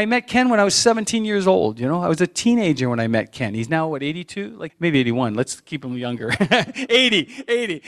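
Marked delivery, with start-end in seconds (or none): 6.4-7.9 higher, laughing through the words; real amused laughter in the voice. Real, not acted. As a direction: crack up while saying it. Joking about friend's age, laughing.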